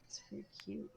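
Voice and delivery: soft whispered compliment; whispering. Real, not acted. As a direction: whisper it close. Aside compliment, unvoiced.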